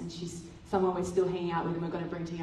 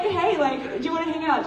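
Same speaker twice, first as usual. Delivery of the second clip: mock eager invite; 9 semitones higher, 6 dB louder than usual.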